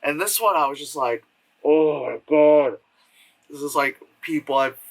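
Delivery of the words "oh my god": loud, slow, drawn-out dismay; exasperated. Acted. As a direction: groan it out in exaggerated despair. Acts exasperated reaction to film.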